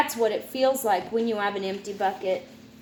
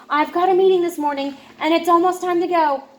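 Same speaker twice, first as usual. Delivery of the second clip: fast, louder rushed talk; hurried mom; 5 semitones higher, 5 dB louder, faster than usual. Acted. Mom rushing out to a meeting, fast. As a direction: rattle it off in a hurry.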